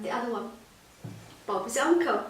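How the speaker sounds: usual speaking voice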